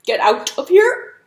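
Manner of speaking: toad croaking a loud command from hiding; burped out at Cockatrice; toad bellowing. A narrator's reading. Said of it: "From there Toad burped".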